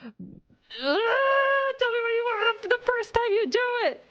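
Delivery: high whiny put-on voice; exasperated whine. Streamer retells replying to vague customer requests, whiny. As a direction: whine it in frustration. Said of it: "And I'm like".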